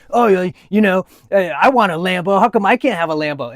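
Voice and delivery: hammed-up aggrieved imitation; upset hater. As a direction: imitate an envious whiner protesting. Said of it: "why he's so upset".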